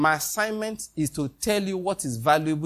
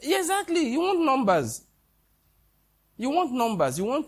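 much higher, pressing each want; high emphatic rebuke; 11 semitones higher than usual. Real, not acted. Preacher chiding pastors who chase crowds, high and emphatic.

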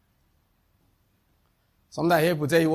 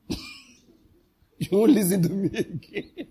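laughing; 6 semitones higher, much slower than usual. Real, not acted. Laughing mid-sentence.

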